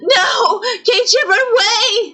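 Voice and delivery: desperate to save him; girl screams at him to run; crying scream. Acted.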